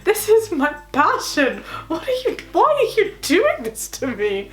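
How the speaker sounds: high, slow, outraged protest; really mad, hammed up. Student to a rival over her research topic, hammed-up mad. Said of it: "but I was really mad".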